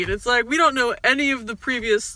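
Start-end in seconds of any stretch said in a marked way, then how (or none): none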